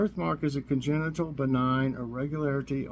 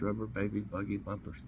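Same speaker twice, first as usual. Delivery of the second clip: fast, softer rattle of the tongue twister; 4 semitones lower, 8 dB quieter, faster than usual. Shown as a demonstration. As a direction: race through the twister quickly. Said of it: "Try to say that three times fast".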